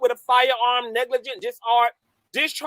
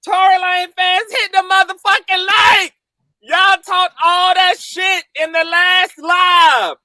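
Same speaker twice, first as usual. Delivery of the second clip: gloating taunt; 6 semitones higher than usual. Real, not acted. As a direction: rub it in their faces. Taunting the defendant's fans.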